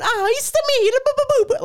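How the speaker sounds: very high, loud cartoon Italian; mock Italian accent. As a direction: squeal it as an Italian caricature. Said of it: "went to an Italian person and started going".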